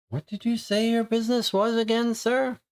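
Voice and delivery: high, prim lady's voice, pitched well up; suspicious lady. Acted. Lady questioning the visitor at her door, in a high, prim voice. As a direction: probe him with polite suspicion.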